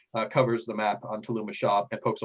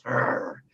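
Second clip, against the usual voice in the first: low grumbling pirate snarl; 3 semitones lower than usual. Acted. Deckhand grumbling after the captain's order, snarling. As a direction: growl a wordless grudging reply. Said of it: "just kind of snarls and goes".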